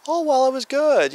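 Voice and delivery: high, sing-song false praise; fake polite answer. Woman lying to the pastor at the door, falsely sweet praise. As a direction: chirp a polite little lie. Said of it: "then she has to lie".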